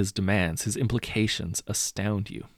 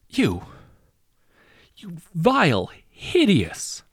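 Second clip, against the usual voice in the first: higher, louder, spitting rage; furious outburst; 10 semitones higher, 6 dB louder, slower than usual. A narrator's reading. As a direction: she explodes at the villain. Wife screams at the hero.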